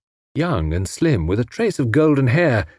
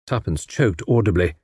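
plain reading voice

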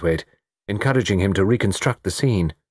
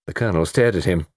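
usual speaking voice

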